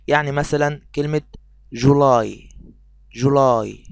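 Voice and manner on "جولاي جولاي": long drawn-out "جولاي" with the y sound held; stretched final y. Shown as a demonstration. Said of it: "لاحظنا ان احنا مديناها".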